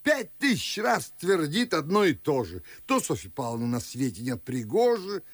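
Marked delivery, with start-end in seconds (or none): 0.0-2.6 exasperated. Acted. Father exasperated by suitor's questions.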